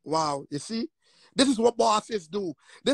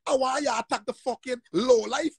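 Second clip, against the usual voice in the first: ordinary talk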